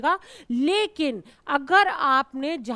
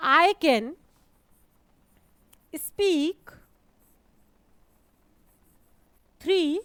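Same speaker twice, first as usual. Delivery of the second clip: slow example; 4 dB louder, much slower than usual. Shown as a demonstration. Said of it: "जैसे मैंने बोला".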